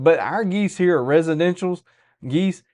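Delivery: ordinary talk, nothing marked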